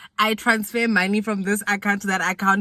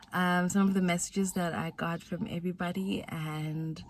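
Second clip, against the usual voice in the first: normal talking voice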